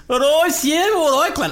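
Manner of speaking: high, put-on Brummie reply; Birmingham accent. Band brothers answer a radio host down the line, Birmingham accent. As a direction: mimic Brummie lads answering the interviewer. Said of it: "didn't know what they sounded like".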